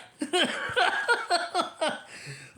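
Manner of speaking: high falling laugh. Real, not acted. Laughing after they suck.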